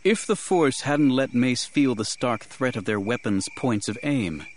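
ordinary delivery, nothing marked

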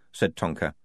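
usual speaking voice

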